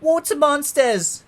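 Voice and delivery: very high, loud, stretched scream of alarm; hammed-up terrified shriek; alarmed cry. A narrator's reading. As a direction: cry out as if seeing monsters. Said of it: "And one cried".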